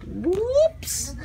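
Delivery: high, soft squeaked whoops; playful whoops. Real, not acted. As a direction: squeak it lightly. Playful reaction in store.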